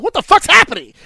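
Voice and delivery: high, loud shocked yell; retelling the moment the scores piled up. Retold or imitated. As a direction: yell it in total disbelief. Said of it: "I said".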